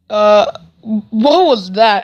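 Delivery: very slow, drawn-out mock bafflement; mocking disbelief. Kid taunting the bully over his punches, mocking disbelief. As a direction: stretch it out as if baffled by a feeble punch.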